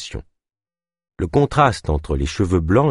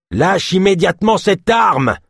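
loud, high bark of a command; barked order; 12 semitones higher, 5 dB louder than usual. A narrator's reading. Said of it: "aboya le soldat".

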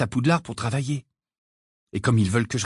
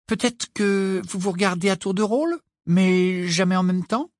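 amused suggestion; 7 semitones higher than usual. A narrator's reading. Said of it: "frémissant en un sourire". (suggestion amusée, aiguë, qui traîne)

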